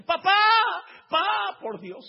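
grito agudo y muy fuerte, con llanto; grito de niño. Acted. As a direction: grita entre sollozos. Isaac grita a su padre. (Isaac suplica a su padre, grito lloroso)

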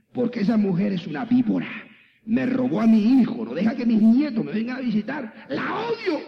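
imitated bitter rant climbing to a high cry; bitter outburst. Woman tells pastor about hated daughter-in-law, bitter outburst. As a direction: act out her resentment, pitch climbing to the end.

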